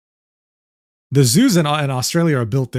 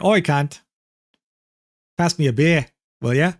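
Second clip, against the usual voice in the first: put-on Australian accent. Explaining slang to chat, in a casual Australian accent. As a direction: toss it off like an Aussie at a barbecue. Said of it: "a suspiciously good accent there".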